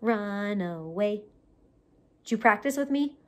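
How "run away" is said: ordinary delivery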